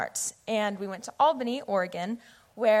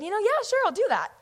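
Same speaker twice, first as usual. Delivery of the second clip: much higher, overly bright yeah sure; hammed-up eager yes you don't mean; fake agreement; 12 semitones higher, 4 dB louder, faster than usual. Teen fake-agreeing to Emma's youth invite, much higher and mock eager. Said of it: "And so I said".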